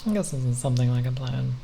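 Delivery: ordinary talk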